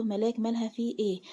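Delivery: usual speaking voice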